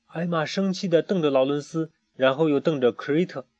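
ordinary delivery, nothing marked

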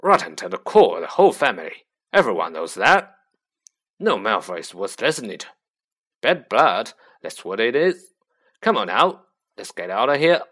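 loud, rough-edged big man's grumble; gruff Hagrid. A narrator's reading. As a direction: grumble loudly about the Malfoys. Hagrid grumbles after the fight.

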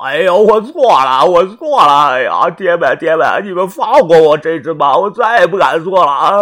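put-on old man begging for his life; begging for mercy. Captive old man begging his captors to spare him, loud and desperate. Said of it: "杨老幺求饶的说道".

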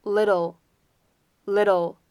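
"little" with the double T as a quick flap; "little" said slowly, tongue flicking the middle T; American flap T. Shown as a demonstration. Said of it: "我们的double T还是发闪音".

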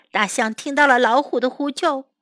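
usual speaking voice

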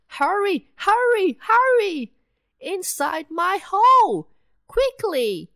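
very high and loud, urgent shouting; tiny mice screaming to escape; panicked mice. Acted. Mice fleeing the cat.

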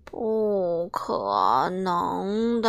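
sleepy drawn-out refusal; slow refusal. Acted. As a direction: drag out a lazy no.

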